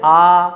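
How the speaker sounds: "आ" as a long, level aa; demonstrated vowel. Shown as a demonstration. Teacher asking students to say it, demonstrated vowel. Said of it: "आ बोलिएगा".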